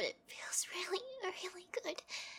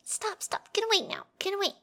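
loud, hurried stop; urgent loud protest; 3 semitones higher, 8 dB louder than usual. Acted. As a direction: push them off out loud. Pushing someone away, flustered.

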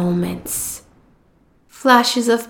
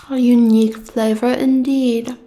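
normal talking voice

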